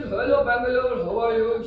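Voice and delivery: big loud mimicked greeting; Usha Uttam. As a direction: imitate a woman's loud, steady hello. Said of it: "if Usha Uttam comes in".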